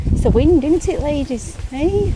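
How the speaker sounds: high, loud sing-song to the hens; pet talk. Real, not acted. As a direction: call up to the chickens, high and bright. Talking to stressed chickens.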